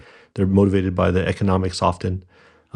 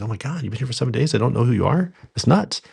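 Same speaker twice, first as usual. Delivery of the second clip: fast and higher, mock shock rising to a squeak; retold disbelief; 5 semitones higher, faster than usual. Owner to a new hire he never met, fast incredulous disbelief. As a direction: rattle it off in mock disbelief, jumping way up at the end.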